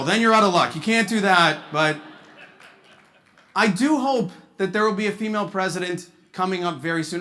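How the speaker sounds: normal talking voice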